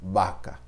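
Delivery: "Vaca" said as "baca"; "Vaca" with a B sound; Spanish B-like V. Shown as a demonstration. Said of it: "o V tem a sonoridade semelhante ao B".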